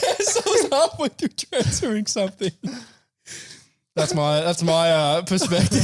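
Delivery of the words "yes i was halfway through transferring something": laughing retort. Retold or imitated. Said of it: "I'm like".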